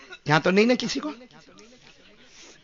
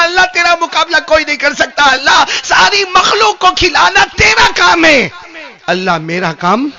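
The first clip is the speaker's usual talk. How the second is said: fervent shouted confession, higher; loud humble prayer; 8 semitones higher, 12 dB louder than usual. A narrator's reading. Solomon kneeling, crying out to God, loud and fervent. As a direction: cry out surrender to God. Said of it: "اور کہا".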